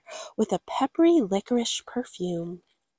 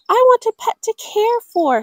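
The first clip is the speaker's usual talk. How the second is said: put-on high, louder girl voice; child's voice; 12 semitones higher, 5 dB louder than usual. Girl telling parents what she wants, high pleading. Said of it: "said Gwendolyn".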